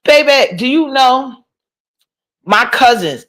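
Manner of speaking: high, disbelieving "Chuck E. Cheese?"; incredulous. Retold or imitated. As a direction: pitch up in mock shock at the idea. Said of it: "I'm like".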